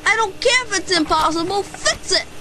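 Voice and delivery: high, loud, pushy insistence; demanding. Acted. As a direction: overrule the mechanic, no arguments. Demanding mechanic on phone.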